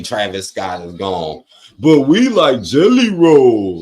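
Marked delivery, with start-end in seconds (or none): 1.8-3.8 slow, louder mock fan voice, a hint of it; sarcastic imitation of fans. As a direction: lightly parody their fake love. Mocking fans who dislike [name].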